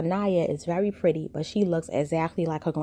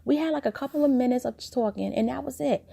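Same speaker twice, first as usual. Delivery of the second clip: higher, light defensive voice; daughter defending; 8 semitones higher than usual. Retold or imitated. Daughter defending the peace talk to her mom, higher. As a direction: brush it off, pitched up. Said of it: "And she's like".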